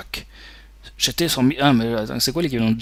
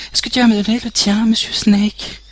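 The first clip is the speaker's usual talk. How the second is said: much higher, louder put-on woman's voice; high medic voice; 8 semitones higher, 4 dB louder than usual. Retaking the medic's line in her high voice. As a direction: switch into the medic's voice, pitched up. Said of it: "j'arrive pas à faire ses voix".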